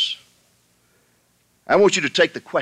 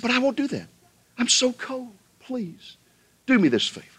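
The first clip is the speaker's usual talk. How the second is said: much higher, wheedling snake begging to be carried; pleading snake; 13 semitones higher than usual. Acted. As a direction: coax him sweetly, begging. Snake begs the hiker.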